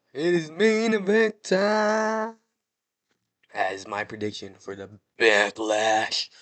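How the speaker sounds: hyping the Backlash main event to viewers; announcer voice. Acted.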